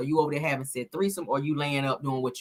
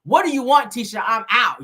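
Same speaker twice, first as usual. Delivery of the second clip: loud, high, irritated demand; impatient mock husband, raised voice; annoyed snap; 5 semitones higher, 5 dB louder than usual. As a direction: bark it out like an annoyed husband at a bar. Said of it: "That's how he asked her".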